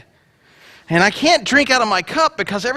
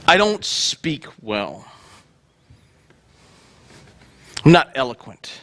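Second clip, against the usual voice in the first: slow low excuse; 8 semitones lower than usual. Acted. Moses making his excuse.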